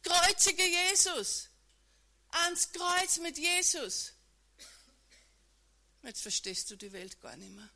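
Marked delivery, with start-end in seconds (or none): 0.0-4.1 higher, louder, slow mob chant; crowd shouting. Acted. Preacher voicing the crowd crying for Jesus's death, loud chant. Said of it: "Sie schrien".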